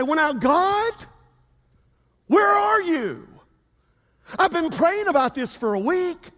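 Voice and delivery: mock pleading with God, rising to a cry; impatient doubter. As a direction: whine it up to a shout. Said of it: "And we say".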